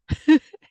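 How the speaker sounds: quick embarrassed chuckle; short laugh. Real, not acted. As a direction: laugh off the slip. Laughs at own slip.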